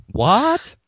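high, sharply rising question; mock disbelief. Quoting a meme to a co-host, in mock disbelief. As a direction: feign shock with a big upward swoop. Mimicking a meme's fake surprise.

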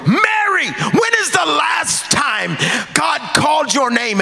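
high, ringing call of Mary. Acted. Jesus calls Mary by name.

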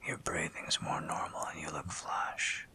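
ordinary talk, nothing marked

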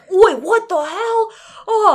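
high, loud, drawn-out mock indignation; hammed-up, high-pitched objection; outraged protest. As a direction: play a put-upon suspect yelping in protest. [name] protests being searched.

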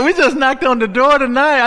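"knocked" shouted high and loud; preacher's shout. Real, not acted. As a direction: fling "We just knocked on the door tonight" out to the crowd. Repeats the line shouted.

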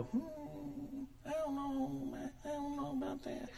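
high, soft, dubious church critic; mock the doubter's hesitant drawl; doubtful skeptic. Skeptical churchgoer doubting the pastor's teaching, mock dubious voice. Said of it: "I get people kinda go".

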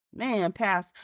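a listener groaning under conviction; stunned churchgoer. Retold or imitated. Voiced listener reacting to preacher.

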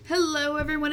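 ordinary delivery

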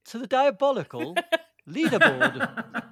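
high, loud, slow showy swoops on "diabolical"; exaggerated retake. Shown as a demonstration. Host carrying on the retake after a no, exaggerated announcement. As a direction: stretch "diabolical leaderboard" big over laughter. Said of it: "let me try again".

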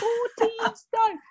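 high, drawn-out disbelief; amazed, squealed echo of the figure; re-enacted astonishment. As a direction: stretch it out as if your eyes are popping. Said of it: "Wow, my eyes shot out my head".

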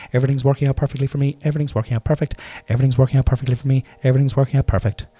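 fast, tumbling repeat of "Everything's working out perfectly for me"; tongue-twister speed run of "Everything's working out perfectly for me"; said quickly. Shown as a demonstration. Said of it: "Try and say that sentence quickly".

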